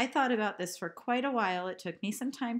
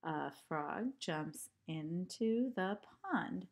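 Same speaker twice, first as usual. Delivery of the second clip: slow recited line; slower than usual. Shown as a demonstration.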